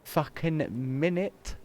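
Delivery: outraged disbelief. Real, not acted. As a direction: stretch it out in disbelief. Reacting to salary cost.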